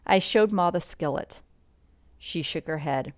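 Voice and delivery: plain reading voice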